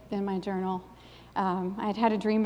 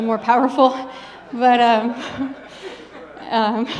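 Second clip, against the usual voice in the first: breaking into real laughter, louder; laughing; 3 semitones higher, 8 dB louder, slower than usual. Real, not acted. Amused by her own King James rebuking, laughing. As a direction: let the laugh spill through the words.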